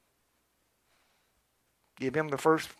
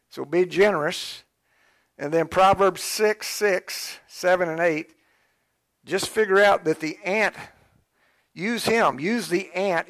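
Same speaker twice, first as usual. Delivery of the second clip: a preacher driving home the ant lesson; full-force preaching; 5 semitones higher, 6 dB louder than usual. Acted. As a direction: preach it to the congregation.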